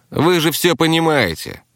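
high-pitched, grudging captive; grudging, through teeth. A narrator's reading. Captive answers the men questioning him, grudgingly. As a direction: force it out through your teeth. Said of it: "процедил пленник".

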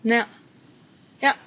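normal talking voice

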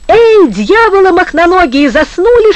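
high, loud scolding holler; rousing yell. A narrator's reading. Коля База rouses the men on sighting a ship, yelling. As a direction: bawl at sleepy crewmates. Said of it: "вдруг завопил на корме Коля База".

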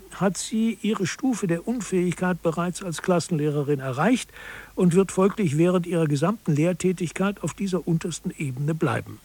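ordinary delivery

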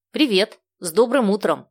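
bright greeting rising to a loud cheer; joyful greeting and loud hurrah; excited. A narrator's reading. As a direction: greet them, then burst out with a happy cheer. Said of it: "ответила Даша".

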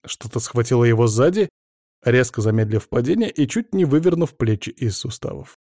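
ordinary delivery

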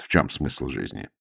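ordinary delivery, nothing marked

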